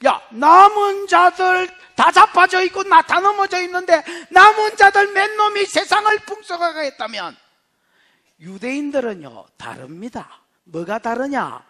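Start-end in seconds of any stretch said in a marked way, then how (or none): 0.1-7.4 loud, raised, impassioned preaching; full-force preaching. Real, not acted. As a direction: build to a forceful, high-pitched proclamation. (목소리 높여 힘차게 설교)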